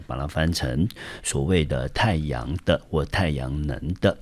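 ordinary talk

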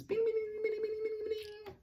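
high, soft bing bing like a bell; bell sounds. Shown as a demonstration. Teacher imitates a ringing bell. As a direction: ring it like a little bell. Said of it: "Something that rings".

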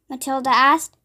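plain reading voice

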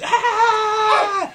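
loud, high-pitched scream; long shrieking "ah" of terror; woman's scream. Acted. Game master as woman seeing the shape, screaming. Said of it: "And then she screams".

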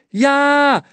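long, high, loud shout; loud girl's yell, pitched high; yelling back. A narrator's reading. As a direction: holler it straight back. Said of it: "brüllte sie zurück".

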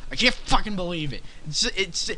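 ordinary talk

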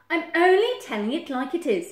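high, loud self-assured badger; insistent Badger. Badger insists, smug self-justifying.

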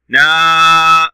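نا held on a steady long aa; long vowel. Shown as a demonstration. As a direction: stretch نا out. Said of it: "كذلك صوت طويل".

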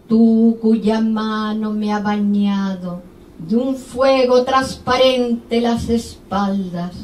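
slow, chanting poetry voice with long vowels; measured, sustained declamation; poem recitation. Acted. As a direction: recite it like an incantation, swelling on the long lines.

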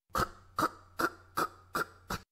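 fast whispered clicking heels; high heel clicks. Retold or imitated. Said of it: "ハイヒールの音を鳴らしながら".

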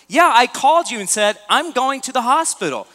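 the wife throwing it back at him; exasperated wife. Retold or imitated. Wife retells her call, exasperated.

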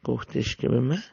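ordinary delivery

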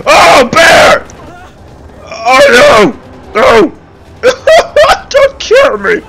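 high, loud mock-terrified squeals; playful panic. Gamer begs a game bear for mercy, squealing in mock panic. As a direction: shriek in hammed-up fright at a bear.

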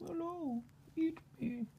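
high, squeaky fruit voice; talking fruit voice. Pretend. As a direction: give the fruit a tiny voice. Voicing the fruit.

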